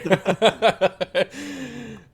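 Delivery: high, loud burst of laughter; real laughing outburst. Real, not acted. Laughing at the table over a spilled jug, loud laugh. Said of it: "making that sound over there".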